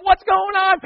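high, loud fake alarm, hammed-up; mock alarm. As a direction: play up the shock for the group. Feigned alarm at staged explosion.

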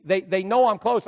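usual speaking voice

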